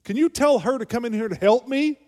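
shrill-free but loud mock demand for help; exasperated shout. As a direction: bark it out, fed up and overworked. [name] shouting in the house.

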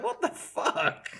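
high, drawn-out, laughing incredulity; amused, drawling disbelief; retold disbelief. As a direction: stretch it out in amused bafflement. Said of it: "I was like".

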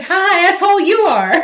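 loud, high mock gloat; a smug villain mocking the defense lawyer, high and loud; gloating jeer. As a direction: crow it at him like a smug villain. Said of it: "And he's like".

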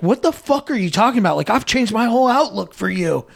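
high-pitched, indignant retort; acted indignation. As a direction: imitate someone hurt and protesting in a quarrel. Acted quarrel, Vax answering back.

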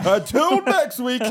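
high, slow booming sign-off; superhero voice. Acted. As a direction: announce it like a hero. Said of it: "you sound like a superhero".

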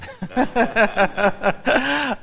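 high, rolling real laughter; hearty laugh. Real, not acted. Preacher amused at covering only verse one, laughing heartily. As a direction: burst out laughing at yourself.

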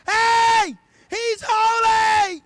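high, loud, slow shout of HOLY; an angel crying out to another angel. Acted. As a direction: bellow it across heaven. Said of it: "An angel over there".

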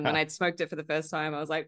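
ordinary talk, nothing marked

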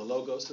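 "logos" with long O sounds, LOH-gohss; English pronunciation. Shown as a demonstration. Preacher opting for English before congregation, "logos" as LOH-gohss. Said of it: "I'll just be English here".

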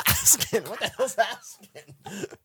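put-on laughing through a failed Jamaican accent; high, slow, giggling mock accent. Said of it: "you're saying it wrong".

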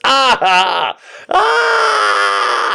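high, rising cries of pain; acted-out long pained wail; pained cry. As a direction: cry out as the pushing hurts. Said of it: "it really hurts".

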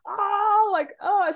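high, breathy little gasps of shock; mock shocked gasp; stunned gasp. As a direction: blurt a stunned "Oh".

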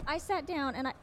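ordinary delivery

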